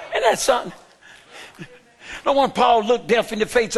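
usual speaking voice

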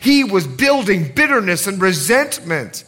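booming pulpit delivery; full-force preaching. Real, not acted. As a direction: drive the point home to the congregation.

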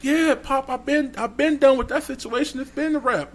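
high, rushed reassurance; high-pitched nervous pleading. Retold or imitated. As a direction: talk fast to calm the dad down. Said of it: "I'm shaking it".

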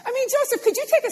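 high, loud giddy disbelief; astonished laughing voice; laughing Peter. Retold or imitated. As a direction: play Peter laughing as he walks on water. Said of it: "I bet he was like".

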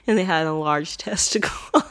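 breaking up with laughter mid-sentence; laughing. Real, not acted. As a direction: try to get it out through real laughter. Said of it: "I'm laughing because".

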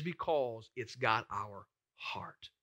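hushed, slow whisper of a confession. Real, not acted. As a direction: lean in and whisper it slowly. Preacher drops voice on key point.

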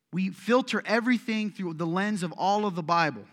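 loud, driving sermon delivery; forceful preaching. Acted. Pastor preaching loudly to his church, forceful. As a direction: preach it hard to the congregation.

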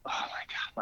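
whispered, worn-out groan of words; imitating an exhausted athlete under the breath; hushed dismay.

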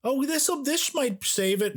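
very high, slow mock optimism; cling to hope in a high, stretched voice; hopeful. Critic who kept wanting it to work, hopeful. Acts out own hopes while watching.

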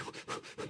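a soft, voiceless sigh of giving in; resigned sigh. Retold or imitated. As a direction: breathe out and surrender. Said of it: "that moment when it's like".

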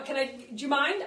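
normal talking voice

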